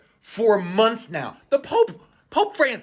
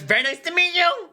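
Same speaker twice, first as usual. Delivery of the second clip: high, smug nerdy voice; squeaky know-it-all lecturing about hierarchy; mocking J D Vance; 10 semitones higher than usual. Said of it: "J D Vance".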